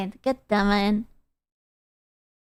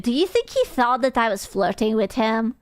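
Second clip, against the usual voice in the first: normal talking voice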